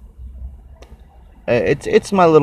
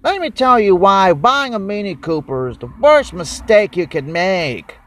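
lightly voiced old car guy, higher, slowing at the end; mimicking the old YouTube mechanic's warning; 8 semitones higher than usual. Mocking the old car guy on YouTube, a light impression. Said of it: "he kinda talks like that".

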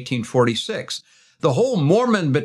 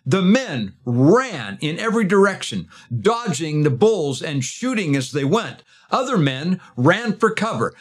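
dramatic storytelling; 4 semitones higher than usual. Acted. Dramatic stampede climax of story.

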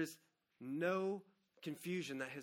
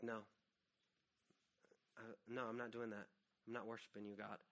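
quiet bitter refusal; 5 semitones lower, 11 dB quieter than usual. Retold or imitated. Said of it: "I was like".

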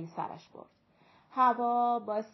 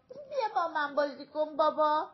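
acted crying whine, high and loud; high, loud mock-tearful plea; tearful child pleading; 6 semitones higher, 5 dB louder than usual. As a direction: plead to dad like a crying little girl. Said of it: "لورنا با گریه گفت".

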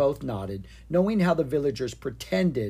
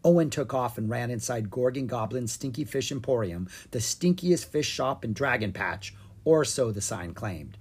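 ordinary delivery

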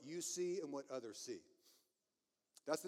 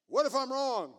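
hammed-up angry shout, high and loud; mock anger; 7 semitones higher, 10 dB louder than usual. Preacher joking about sounding angry, mock anger. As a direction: pretend to be furious, playfully overdone. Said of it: "I am angry".

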